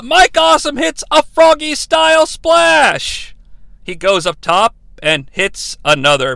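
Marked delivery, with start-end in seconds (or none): 0.0-3.3 high, sustained play-by-play call; hyped announcer shout. Acted. Recapper calling a splash off the ropes, hyped shout. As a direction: belt out the big move.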